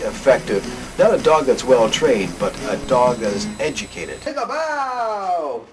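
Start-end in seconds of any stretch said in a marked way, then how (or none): 4.2-5.7 high, slow cheerful command; sing-song trick cue; dog command. Real, not acted. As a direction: cue the dog brightly and slowly. Cueing the dog on camera.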